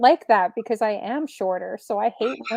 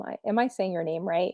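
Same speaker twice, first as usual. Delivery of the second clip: usual speaking voice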